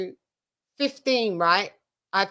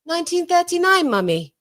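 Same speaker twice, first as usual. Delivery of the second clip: put-on child's voice; 9 semitones higher than usual.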